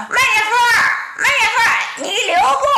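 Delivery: high, loud shout from behind; loud call. Acted. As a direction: call him back at the top of your voice. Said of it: "就听后边有人高声喊道". (从后面高声大喊)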